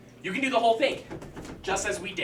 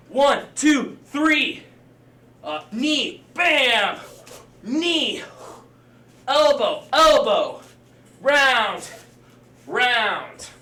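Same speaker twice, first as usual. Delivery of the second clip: louder, higher shouted strike calls; boxing coach calling combos; 4 semitones higher, 6 dB louder, much slower than usual. Shown as a demonstration. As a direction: bark each strike like a drill sergeant. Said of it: "If you're in the air, same thing".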